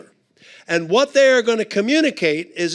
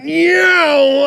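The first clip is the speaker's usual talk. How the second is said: high, loud yelp of escape; panicked no; 9 semitones higher, 8 dB louder than usual. Retold or imitated. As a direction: blurt it out as you bolt. Fleeing the embarrassing mix-up.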